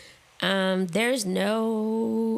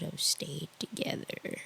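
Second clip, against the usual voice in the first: whisper; 5 semitones lower, 10 dB quieter than usual. Real, not acted. Confessing about parents.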